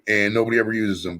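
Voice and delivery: ordinary talk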